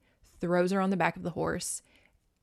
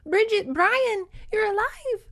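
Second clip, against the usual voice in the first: high-pitched, emotional cry of relief; acted child's joy, very high and drawn out; overjoyed relief; 14 semitones higher, 4 dB louder than usual. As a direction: squeal it as the girl finding the babies alive. Voices overjoyed girl finding siblings alive.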